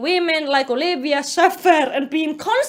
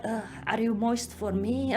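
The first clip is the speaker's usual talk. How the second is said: low, quiet creep's come-on; creepy old man; 6 semitones lower, 11 dB quieter than usual. Retold or imitated. Old man being inappropriate to Olivia, creepy murmur. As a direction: drop low and soft, creepily. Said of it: "way more creepy than this".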